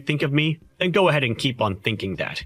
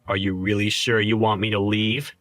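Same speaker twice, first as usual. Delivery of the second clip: ordinary talk, nothing marked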